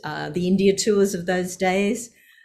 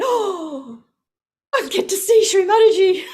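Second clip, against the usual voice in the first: high and loud, delighted; thrilled; 12 semitones higher, 6 dB louder than usual. Retold or imitated. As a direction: gush it like an excited girl. Thrilled at being called.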